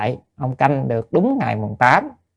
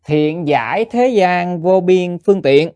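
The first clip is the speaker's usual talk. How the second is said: slow, raised and louder, chanting the scripture line; sutra recitation; 4 semitones higher, 4 dB louder, slower than usual. Acted. A nun reading the sutra line to her listeners, slow solemn recitation. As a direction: intone it like a sacred text, slowly.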